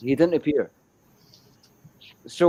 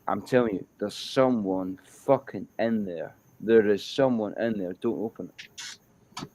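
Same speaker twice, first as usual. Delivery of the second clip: usual speaking voice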